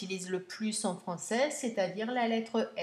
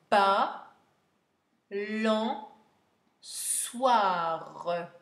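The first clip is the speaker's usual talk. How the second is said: "balançoire" very slow, with long gaps between syllables; 4 dB louder, much slower than usual. Shown as a demonstration. Teacher dictating the last word, syllable by syllable. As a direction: draw out each syllable of "balançoire".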